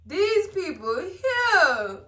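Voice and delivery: high, drawn-out exclamation after a laugh; laughing disbelief. Real, not acted. Host reacting to these people, laughing disbelief. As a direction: stretch it out high, tickled.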